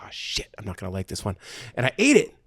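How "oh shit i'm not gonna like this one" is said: muttered aside. Retold or imitated. Private doubt about a burger.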